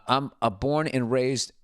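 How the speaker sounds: ordinary delivery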